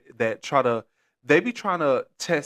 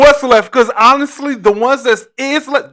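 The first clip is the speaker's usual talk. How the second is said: loud, high and laughing; excited shout; 11 semitones higher, 13 dB louder than usual. Real, not acted. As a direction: shout it out cracking up. Laughing outburst after joke.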